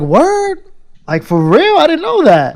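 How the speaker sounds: high squeaky disbelief; mock surprise. Narrator replays hearing Def Jam, high mock amazement. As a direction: play it like it can't be true. His own awed reaction retold.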